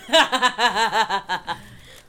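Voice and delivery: loud, rolling cackle; long, loud peal of laughter; real laugh. Real, not acted. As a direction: burst out laughing loudly. Laughing at redhead nightmare.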